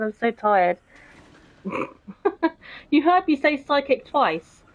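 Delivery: ordinary talk, nothing marked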